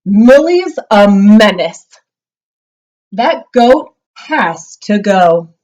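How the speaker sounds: loud exasperated father; mock-angry dad's shout; dad yelling. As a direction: bellow it like a fed-up dad. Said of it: "Dad yelled".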